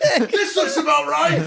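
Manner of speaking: high, slow words through laughter; mock the dad's proud look while laughing; laughing mock pride. Real, not acted. Mocking the dad's wall job.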